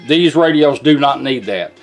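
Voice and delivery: ordinary delivery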